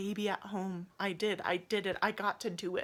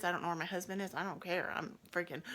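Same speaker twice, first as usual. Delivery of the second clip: usual speaking voice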